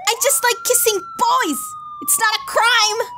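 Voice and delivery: high, whiny-comic protest; protesting weirdo. Acted. Boy Kisser protesting after police are called, high defensive voice. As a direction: plead your case in a high put-on voice.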